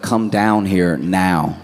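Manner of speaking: slow command. Retold or imitated. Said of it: "they were like".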